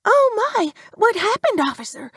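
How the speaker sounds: put-on high-pitched startled young woman; young woman's voice. A narrator's reading. Young driver to the officer on the road, high and startled.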